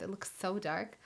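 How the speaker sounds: ordinary talk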